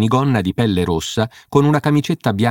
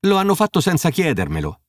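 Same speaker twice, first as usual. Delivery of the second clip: lightly whiny excuse, pitched up; a hint of a complaining host; mildly plaintive, dodging the blame; whining; 8 semitones higher than usual. A narrator's reading. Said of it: "rispose Geoffrey con tono lamentoso".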